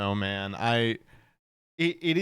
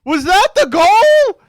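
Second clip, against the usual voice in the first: incredulous; 12 dB louder, slower than usual. Retold or imitated. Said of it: "And I was like".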